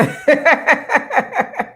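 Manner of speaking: a loud, high laugh at the glitch; burst of laughter. Real, not acted. Laughs after the stream skipped.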